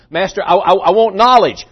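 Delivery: mock gasping for breath, stammering the start. Young man to Socrates after being held under water, gasping. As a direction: act out a half-drowned man catching his breath. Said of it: "Young man's gasping".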